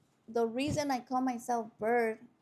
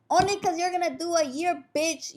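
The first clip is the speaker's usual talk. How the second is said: high, loud mock retort; imitated angry comeback; 6 semitones higher, 4 dB louder than usual. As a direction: fire back like a quarrelling inmate. Acts inmate snapping back in quarrel.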